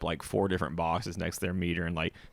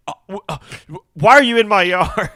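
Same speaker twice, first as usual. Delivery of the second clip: much higher, louder, alarmed voice; alarmed homeowner; 12 semitones higher, 12 dB louder than usual. Retold or imitated. Homeowner startled to find a worker in the yard. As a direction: squeak it out in alarm. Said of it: "they're just like".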